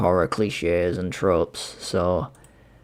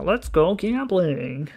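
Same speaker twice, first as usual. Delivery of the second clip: hype meme voice; 8 semitones higher, much slower than usual. Pretend. Quoting the gambling meme.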